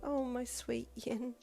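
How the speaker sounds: soft, slow, fond inner voice; fond soft thought. A narrator's reading. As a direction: think it softly with affection. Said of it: "she thought with a little giggle".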